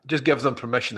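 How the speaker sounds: normal talking voice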